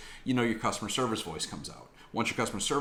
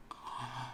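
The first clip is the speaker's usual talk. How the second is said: gasp; 15 dB quieter than usual. Real, not acted. Tasting a Kit Kat.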